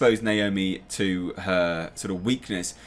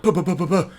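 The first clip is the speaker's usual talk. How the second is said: rapid higher-pitched "buh" gunshots; imitated gunfire; 7 semitones higher, faster than usual. Reviewer acting out the fight, imitated gunfire. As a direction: spit out mock gunfire noises.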